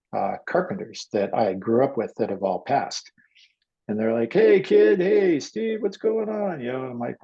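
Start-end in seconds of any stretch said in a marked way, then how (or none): 4.3-6.6 high-pitched, loud call across a job site; acted excited hello; hearty greeting. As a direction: hail an old pal, imitating the crew. Old carpenters greeting him in dream.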